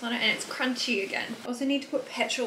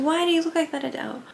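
lightly mimicked questioner, pitched up; imagined viewer's question; 6 semitones higher, 3 dB louder, faster than usual. Vlogger voicing viewers who wonder about [name]'s look, mock questioning. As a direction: ask it higher and louder, as a curious onlooker. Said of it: "If you're wondering".

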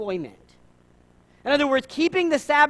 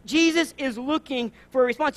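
normal talking voice

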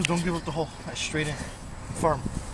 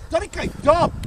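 excited; 13 semitones higher, 3 dB louder than usual. Real, not acted. Reacting to a drive.

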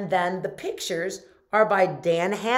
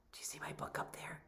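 whispering; 19 dB quieter, much faster than usual. Real, not acted. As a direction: gasp then a hushed whisper. Excited secret about the book.

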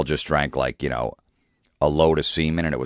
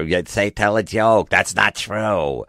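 higher, slow, whiny heckling; mock heckler girl; 5 semitones higher, slower than usual. Heckling girlfriend taunting the comic, whiny mock voice. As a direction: whine it back at the stage. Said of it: "the girl will be yelling at me".

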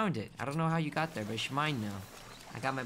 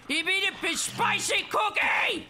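mock-furious shouting; 12 semitones higher than usual. Player attacking the party that snubbed Snoot, mock-furious shout. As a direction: shout in hammed-up indignation.

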